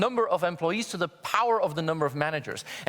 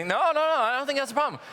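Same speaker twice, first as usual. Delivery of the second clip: exasperated no; 9 semitones higher, 4 dB louder, faster than usual. Retold or imitated. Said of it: "I say".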